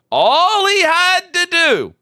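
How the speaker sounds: high, loud, slowed exasperated stress; exasperated emphasis. Real, not acted. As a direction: lean hard on it in disbelief. Exasperated point about trolls.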